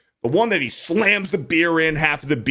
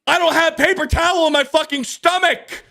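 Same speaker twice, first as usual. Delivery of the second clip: high, indignant comeback; indignant retort; 13 semitones higher than usual. Retold or imitated. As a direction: fire back at him in mock outrage, pitched way up. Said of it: "and I'm like".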